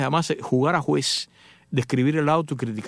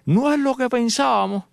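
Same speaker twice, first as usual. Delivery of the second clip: higher, slow, drawn-out neighbor voice; neighbor relieved; 9 semitones higher, 3 dB louder than usual. Retold or imitated. Neighbor calls to his wife about the new cars, higher and slow. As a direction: let the neighbor sing it out in relief. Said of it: "le ha dicho a la mujer".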